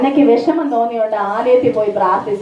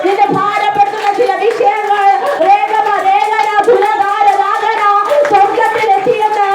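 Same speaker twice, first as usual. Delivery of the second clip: fervent prophetic preaching; 6 semitones higher than usual. Acted. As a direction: proclaim it over the crowd, rising. Prophetic message with tongues.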